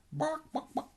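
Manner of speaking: very high yappy barks; dog barking. Acted. Hypnotized Donald barking like a dog. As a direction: yap like a little dog.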